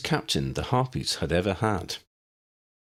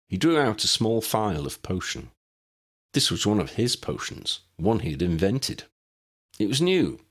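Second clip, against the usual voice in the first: ordinary delivery, nothing marked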